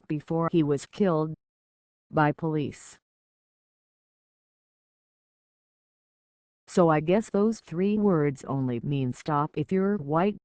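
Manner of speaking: rhythmic spoken-word delivery to a crowd; slam poetry. Acted. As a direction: perform it like a slam poet on stage. Slam poem performed on stage.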